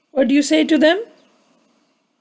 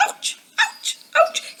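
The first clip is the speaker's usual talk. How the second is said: soft, voiceless "ouch" repeated; pained ouches; 6 dB quieter, slower than usual. A narrator's reading. Douglas kept going with splinters, whispered ouches. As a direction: wince out each ouch under the breath. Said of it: "he kept going".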